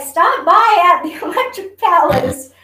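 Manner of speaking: mock ad pitch. Podcaster imitating Bart's campaign ad, hammed-up salesman pitch. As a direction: sell it like a hammed-up campaign spot. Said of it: "And then he's like".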